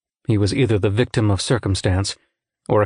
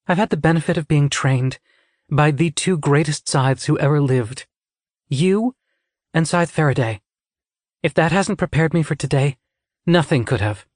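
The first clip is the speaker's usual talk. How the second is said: put-on higher, earnest young woman; lightly voiced anxious apprentice; young Citra; 5 semitones higher than usual. A narrator's reading. Citra answering her mentor before conclave, earnest.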